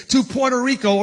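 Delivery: ordinary talk, nothing marked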